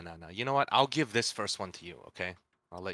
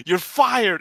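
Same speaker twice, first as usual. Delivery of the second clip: loud, high mock yell; hammed-up yell; mock shout; 12 semitones higher, 13 dB louder than usual. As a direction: yell it like a fired-up vendor. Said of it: "slow-talking hot dog vendor".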